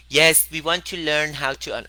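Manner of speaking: mocking mom impression; imitating mom. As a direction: mimic mom's earnest tone. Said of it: "That's how my mom talks, exactly like that".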